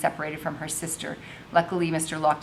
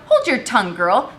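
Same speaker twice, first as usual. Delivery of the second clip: louder stern command; 4 semitones higher, 9 dB louder than usual. Acted. Madam silences Isabel, stern loud command. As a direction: shut the girl up sharply. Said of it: "Madam commanded".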